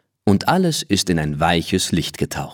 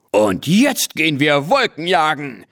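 übermütiger Ruf; 7 semitones higher than usual. A narrator's reading. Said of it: "ruft Tommy".

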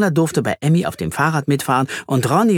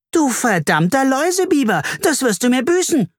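girl shrieks at the boy after her teddy falls, high mock fury; mild mock scream; 12 semitones higher than usual. A narrator's reading. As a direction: threaten him with shrill indignation. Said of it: "schrie Carla".

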